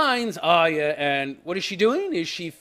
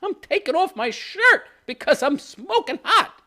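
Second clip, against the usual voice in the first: mock high voices; 12 semitones higher than usual. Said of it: "And she's like".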